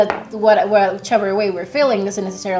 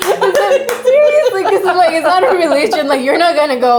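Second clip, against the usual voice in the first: high-pitched, laughing through the words; laughing protest; 10 semitones higher, 5 dB louder than usual. Real, not acted. Friend laughing about dad's view of psychology, laughing protest. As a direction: argue your point while cracking up. Laughing over the religion joke.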